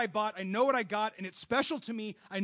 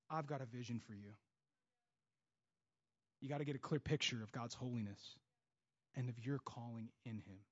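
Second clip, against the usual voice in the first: hushed; 9 semitones lower, 10 dB quieter than usual. Real, not acted. Sermon drops to an intimate close.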